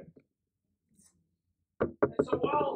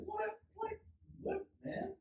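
high-pitched mock disbelief; hammed-up astonishment at Jonah; incredulous onlooker; 12 semitones higher, 4 dB quieter, slower than usual. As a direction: react in baffled, squeaky short bursts. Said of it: "We look at Jonah and say".

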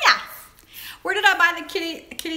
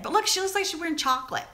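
ordinary talk

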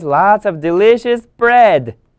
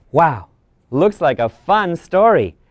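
usual speaking voice

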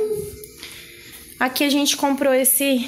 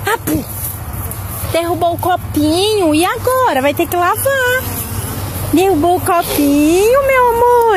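high, loud sing-song scolding; 5 semitones higher, 5 dB louder than usual. Real, not acted. Mother teasing baby over spilled cup.

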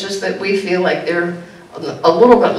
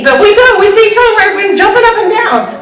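much higher and louder, bubbling over; excited; 13 semitones higher, 6 dB louder than usual. Real, not acted. Preacher describing members meeting, loud excited. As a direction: let the joy burst out as you picture it.

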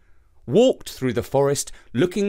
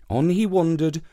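ordinary delivery, nothing marked

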